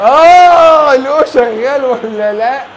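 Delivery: mock echo. Real, not acted. Echoing friend's line mockingly.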